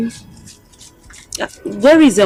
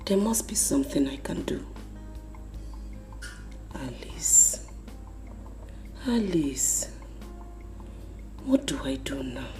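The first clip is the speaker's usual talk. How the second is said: very soft, half-whispered soliloquy; hushed brooding; 3 semitones lower, 14 dB quieter than usual. Acted. Mother alone vowing not to allow this, hushed brooding. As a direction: mutter it to yourself, barely voiced. Alone, plotting under her breath.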